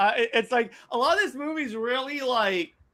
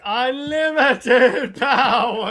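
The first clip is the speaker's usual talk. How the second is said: villain voice; 3 dB louder, much slower than usual. Acted. Quoting villain line, laughing.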